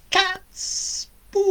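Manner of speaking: high, slow, drawn-out witch croon. Acted. Baba Yaga gloating over the spoons, high witch voice. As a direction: savour it like a greedy old witch. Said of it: "touched the second one".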